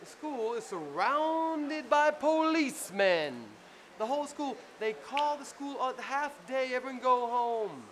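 telling friends the school was surrounded, high and slow; dramatic alarm. Retold or imitated. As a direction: paint the panic of police arriving. Dramatic rise of the tale.

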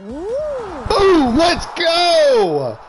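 high, loud cheer; excited shout. Real, not acted. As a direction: cheer the win at full volume. Cheering a win in game.

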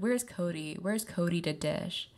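usual speaking voice

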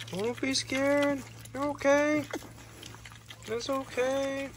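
high, slow, soft soothing; calm a frightened piglet gently; reassuring. Real, not acted.

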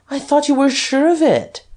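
high-pitched, mocking jeer. A narrator's reading. Duke mocking Guerchard's doubt, high jeering tone. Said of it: "in a jeering tone".

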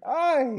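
very high, loud shriek; piercing scream. A narrator's reading. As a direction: scream as if having your throat cut. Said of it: "she was having her throat cut".

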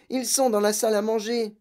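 high, tight, panicky voice; strangled alarm. A narrator's reading. Mother telling family where burglars are, strangled alarm. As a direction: squeeze it out high in fear. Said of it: "d'une voix étranglée".

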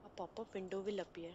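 ordinary delivery

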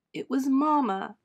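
put-on a boy breaking down in tears; boy sobbing to his uncle about his mama. A narrator's reading. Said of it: "Sugar sobbed".

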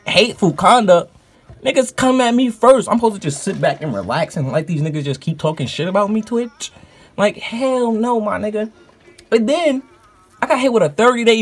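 indignant rant; outraged. Real, not acted. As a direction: protest like it's plainly unfair. Outraged over unfair ban.

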